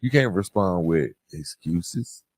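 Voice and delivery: ordinary talk, nothing marked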